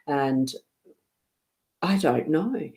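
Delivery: ordinary talk, nothing marked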